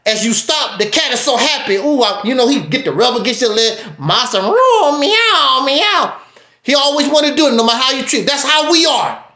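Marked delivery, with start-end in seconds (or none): 4.0-6.2 slow, high, drawn-out mock meow; purring cat slave. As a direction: play a fawning cat begging its master, very slow. Slave as happy cat rubbing.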